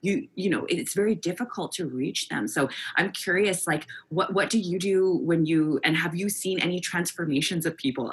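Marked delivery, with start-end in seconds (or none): none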